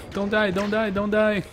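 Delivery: high, loud desperate plea; panicked pleading. Real, not acted. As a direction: scream it in panic.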